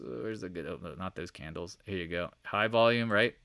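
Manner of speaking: normal talking voice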